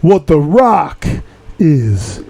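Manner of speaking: slow drawn-out Rock catchphrase; imitating The Rock's slow catchphrase. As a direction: stretch it out like The Rock on the mic. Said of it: "Let's talk about The Rock".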